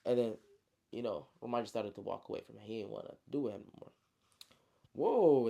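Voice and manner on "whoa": put-on kid's startled whoa. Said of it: "the kid said".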